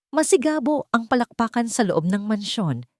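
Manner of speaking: ordinary delivery, nothing marked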